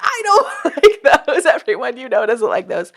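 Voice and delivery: high mock-outraged protest through laughter; hammed-up indignant objection; mock rage text. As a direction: play an irate texter, laughing. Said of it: "I'm gonna get text or rage text".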